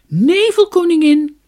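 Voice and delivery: a clear, brave little voice, high and loud; brave clear call. A narrator's reading. Dunja bravely calls the fog queen, high loud clear call. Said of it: "met een helder, dapper stemmetje riep ze".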